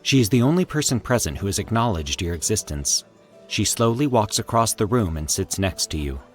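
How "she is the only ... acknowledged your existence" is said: plain reading voice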